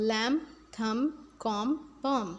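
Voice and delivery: lamb, thumb, com, palm stopping on the M; lamb, thumb, com, palm with the final B unspoken; silent B. Shown as a demonstration. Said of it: "हम बी को pronounce नहीं करेंगे".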